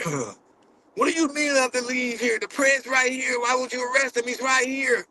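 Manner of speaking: high, outraged mock protest; mock freakout. Mocking how he could have yelled at the officers, high mock freakout. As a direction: ham up a panicked outburst at the cops. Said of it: "the Gideon chimp out or trip out".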